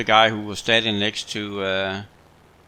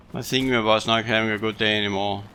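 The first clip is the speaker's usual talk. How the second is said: usual speaking voice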